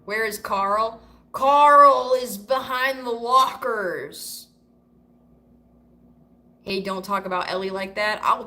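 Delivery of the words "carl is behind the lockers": loud, slow, drawn-out mock holler; hammed-up holler. Streamer answering where Carl is, in a drawn-out holler. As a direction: bellow it out slowly, playing it up.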